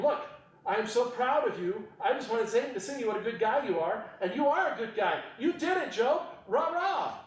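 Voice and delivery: high, bouncy cheerleader pep; mock cheerleader God praising Job; cheerleading God. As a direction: cheer Job on like a squad leader. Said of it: "God doesn't even say to him".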